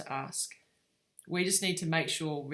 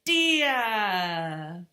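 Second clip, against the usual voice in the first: put-on loud, long toddler call; imitating toddler; 3 semitones higher, 6 dB louder than usual. Mum imitating her son as he points, loud drawn-out toddler voice. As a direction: draw the word out like a one-year-old. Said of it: "he would point and say".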